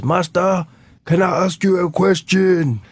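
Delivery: lightly voiced sly old man asking the policeman politely; grandfather asking. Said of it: "he says".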